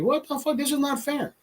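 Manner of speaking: high, hushed mock protest; high quiet mock indignation; mock outrage. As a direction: squeak out the unfairness, quietly. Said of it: "You're like".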